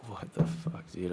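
whispered disbelief under the breath; muttering to himself, caught by the cop, whispered; stunned whisper. Retold or imitated. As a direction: breathe it out in shock. Retelling shock at being let go.

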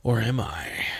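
teasing whisper. Acted. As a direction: breathe it as a sly secret. Teasing aside after joke.

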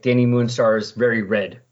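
ordinary talk

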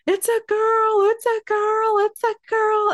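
imitating a joyful shout, drawn out; excited announcement. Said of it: "And they're like".

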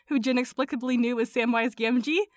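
normal talking voice

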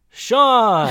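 loud high call of "Sean!"; calling out to a friend; shouted name. Retold or imitated. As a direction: yell his name across the room. Calling out across the room.

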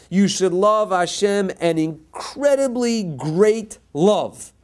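slow emphatic. Shown as a demonstration. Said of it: "rabbi translating with emphasis".

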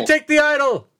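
tossing off the idol line as a mock hero; movie quote. Fans trading Raiders lines, quoted with playful mock drama.

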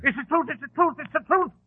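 desperate to be believed, pushing it again and again; agitated insistence. Acted. Leader insists he is royal.

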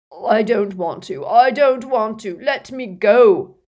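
loud, rising wail; desperate plea. A narrator's reading. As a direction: beg frantically to be freed. Tormented Dumbledore begs to be released.

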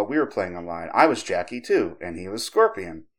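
usual speaking voice

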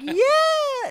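high, loud whoop; excited cheer. Retold or imitated. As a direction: cheer like a party crowd. Crowd cheering at party.

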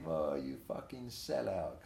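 slow, drawn-out jeer; mock scorn. Tattooists jeering at his Ed Hardy gown, slow sneer. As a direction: sneer it out slowly. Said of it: "So they're all just like".

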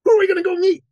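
put-on very high little-boy voice; excited little boy. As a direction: imitate a small boy eagerly pestering his mom. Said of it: "that excited way little boys do".

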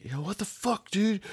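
very high-pitched, put-upon protest; mock indignation.

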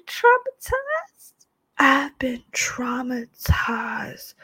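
drawn-out, comically distressed wail; traumatized. Real, not acted. Vlogger traumatized by the way Koreans eat corn, slow wail. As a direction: stretch the word slowly into a high whine.

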